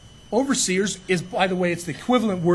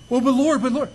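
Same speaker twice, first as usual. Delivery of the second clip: whiny protest; 6 semitones higher, 6 dB louder than usual. Retold or imitated. Pastor protesting to God in prayer.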